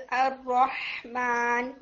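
الرحمن drawn out slowly with clear ha; teacher showing makhraj of ha; slow tajweed demo. Shown as a demonstration. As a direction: stretch الرحمن, the ha from the throat. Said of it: "جیسے for example".

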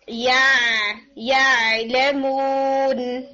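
یا drawn out very slowly with the ain held steady; a long, steady ain in یا لیمون. Shown as a demonstration. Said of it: "آپ اس کو time دیں گے".

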